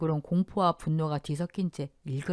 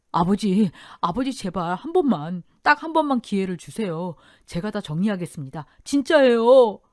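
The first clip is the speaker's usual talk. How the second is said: urgent plea that bursts out at the end; desperate begging; 3 semitones higher, 6 dB louder than usual. A narrator's reading. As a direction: beg your father for one chance. Husband on knees begging father.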